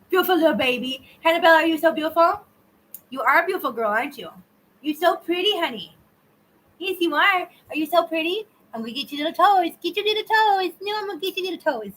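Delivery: high cooing pet talk. Real, not acted. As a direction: coo and tease the kitten. Cooing to the kitten.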